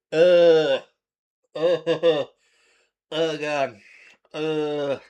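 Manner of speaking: loud strained groan into a laugh; pained groan and laugh. Real, not acted. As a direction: groan then laugh at the pain. Reacting to the spicy burn.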